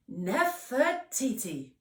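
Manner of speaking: slow, clear repeat of her name; patient repeat. Acted. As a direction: spell it out for her, slowly.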